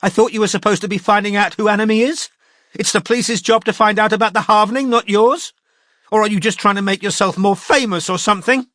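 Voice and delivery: high, loud, fast angry outburst; lashing out. A narrator's reading. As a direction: snap back at him. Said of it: "she lashed out".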